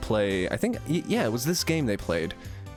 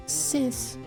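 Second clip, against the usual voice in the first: put-on high girl voice; 13 semitones higher than usual. Character voice in game dialogue.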